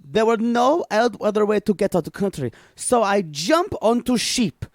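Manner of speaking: broken-English Olga, deadpan and boastful; Olga character. Acted. A made-up Olga telling the hosts about her trip, deadpan and broken. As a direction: play Olga as a blunt foreign traveler.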